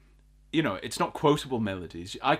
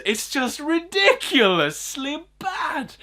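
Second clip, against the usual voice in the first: exasperated; 14 semitones higher, 4 dB louder, slower than usual. Real, not acted. Exasperated verdict on song.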